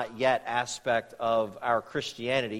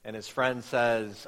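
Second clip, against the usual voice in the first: ordinary talk, nothing marked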